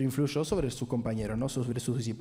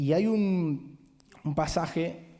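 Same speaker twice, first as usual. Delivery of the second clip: ordinary talk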